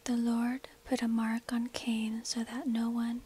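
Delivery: usual speaking voice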